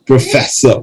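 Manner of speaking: ordinary delivery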